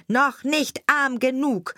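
high, slow, measured taunt; taunting sparrow. A narrator's reading. Sparrow to the lamenting carter, high deliberate taunt. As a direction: pitch it up and spell out each word at the carter.

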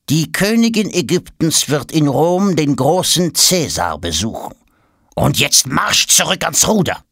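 high, commanding servant order; stern command. Acted. Servant sternly ordering Tom back to rowing. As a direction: send him back to the oar.

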